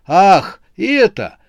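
high, loud burst of relieved delight; joyful exclamation. A narrator's reading. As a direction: exclaim as if the answer just struck you. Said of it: "радостно воскликнул он". (радостное восклицание)

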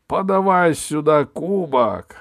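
slow, loud royal order; booming warrior king. Acted. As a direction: bark the order at the servant. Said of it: "обернулся он к камердинеру".

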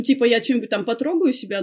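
ordinary delivery

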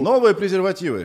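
high pitched advert pitch; mock ad voice. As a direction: announce it like a commercial. Mock ad slogan.

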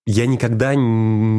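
ordinary delivery

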